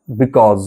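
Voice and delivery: because ending in a buzzing z: "bikawz". Shown as a demonstration. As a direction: say because with a clear final "z". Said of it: "জয়ের উচ্চারণ করবে".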